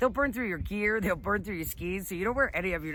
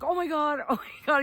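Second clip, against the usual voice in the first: higher-pitched mock panic; retold shock; 8 semitones higher, 3 dB louder than usual. Stabbed skier reacting to the wound, shocked. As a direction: re-enact a startled, raised-pitch reaction.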